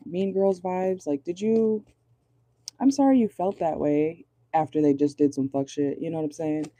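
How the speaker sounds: usual speaking voice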